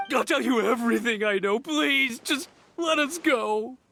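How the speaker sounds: scared captive begging to be let go; desperate plea. Acted. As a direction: plead for your life. Captured thug begs to be freed.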